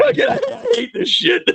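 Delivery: high-pitched laughing through the words; cracking up while pretending to be furious; laughing fit. Real, not acted. Laughing at Marty's correction, half-crying with laughter. Mock outrage at friend Marty.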